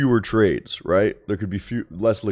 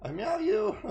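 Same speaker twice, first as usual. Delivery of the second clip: very high, very soft coo to a pet; high hushed pet voice, meows included; 7 dB quieter than usual. Real, not acted. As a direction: sweet-talk the cat in a tiny high voice. Cooing at the cat.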